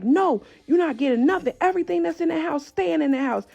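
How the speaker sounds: imitating an angry father, high-pitched; angry refusal. Father refusing to let child get her stuff, angry.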